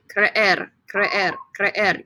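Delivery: "Creer" with two separate E sounds, kreh-EHR; both E's pronounced. Shown as a demonstration. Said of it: "You pronounce both E's".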